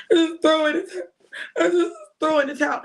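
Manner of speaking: high, laughing mock wail; mock whining quitter. Mocking a quitter for the listeners, high wail. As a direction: whine like someone giving up, half laughing. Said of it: "You have to be like this".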